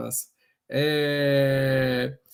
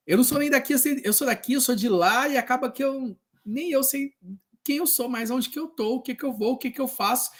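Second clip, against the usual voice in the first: quoting frustrated colleague; 6 semitones higher than usual. Retold or imitated. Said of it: "De falar assim".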